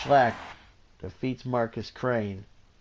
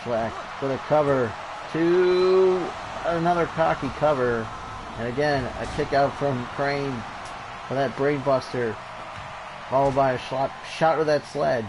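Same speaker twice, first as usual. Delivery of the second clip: play-by-play commentary; 4 semitones higher, 5 dB louder than usual. Acted. Calling the pin and moves.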